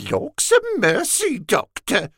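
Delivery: high mock-female simpering; a young blood camping it up as a coy woman; womanish simper. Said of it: "feigning a womanish simper".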